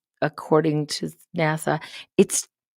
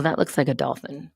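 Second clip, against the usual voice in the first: ordinary talk, nothing marked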